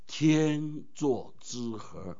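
天作之合 stretched out slow and soft; slow emphasis. Shown as a demonstration. Preacher dwelling slowly on the wedding phrase. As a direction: lay out 天作之合 syllable by syllable. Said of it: "我们结婚的时候".

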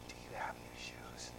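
voiceless whisper from far off. Retold or imitated. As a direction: whisper the question so it barely carries. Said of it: "she would whisper something".

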